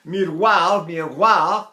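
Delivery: "miroir" said the French way. Shown as a demonstration. Teacher modelling a French word. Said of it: "So if I say".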